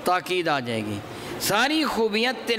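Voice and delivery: ordinary talk, nothing marked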